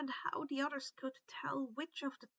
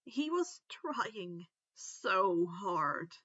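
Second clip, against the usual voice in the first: slow, lower, close to tears; holding back tears; 6 semitones lower, much slower than usual. A narrator's reading. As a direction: drag it out as if fighting tears. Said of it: "ignoring the burning in his eyes".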